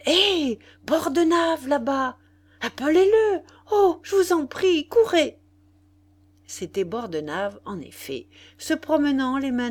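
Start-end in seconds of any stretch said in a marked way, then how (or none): 0.1-5.4 high, loud, urgent hail; exclaimed calling out. A narrator's reading. Nana hailing Bordenave across the lawn, urgent calling out. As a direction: shout to someone across the field. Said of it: "l'interrompit par une exclamation".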